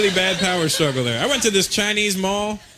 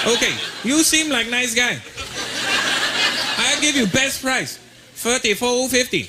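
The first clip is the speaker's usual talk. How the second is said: higher, slow mock Chinese accent; slow sales pitch in a Chinese accent, pitched up; Chinese shopkeeper accent; 4 semitones higher, slower than usual. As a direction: shopkeeper offering best price, slow and high in a Chinese accent. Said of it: "I'm going".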